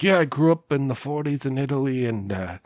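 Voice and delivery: lightly put-on Italian accent; a bad Italian accent. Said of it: "It was a terrible Italian accent".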